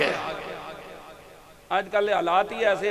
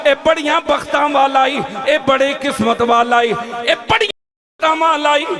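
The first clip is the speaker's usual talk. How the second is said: high, loud impassioned preacher's cry; full-force preaching; 8 semitones higher, 9 dB louder than usual. Acted. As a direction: proclaim it to the crowd, stretching the calls. Preacher voices the story at full force. (ऊंची आवाज़ विच जोशीला was)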